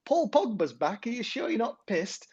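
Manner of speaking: attempted Manc accent. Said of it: "a proper Manc accent for this".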